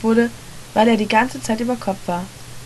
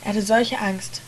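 usual speaking voice

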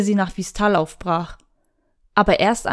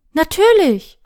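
bright-hearted, high and louder agreement; eager promise; 6 semitones higher, 6 dB louder, slower than usual. A narrator's reading. Apprentice promises her mentor to pass on greetings, eagerly. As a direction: promise it emphatically, pitch leaping up.

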